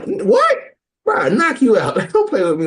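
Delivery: high shocked what, then a loud threat; mock outrage. Podcast host reacting to a shady counselor, loud mock outrage. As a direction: play it scandalized, voice jumping way up.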